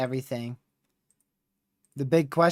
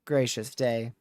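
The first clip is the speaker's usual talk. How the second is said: ordinary delivery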